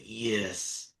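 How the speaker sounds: deep, quiet villain voice; evil villain voice. Retold or imitated. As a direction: purr it like a Disney villain. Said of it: "why'd you have an evil villain voice".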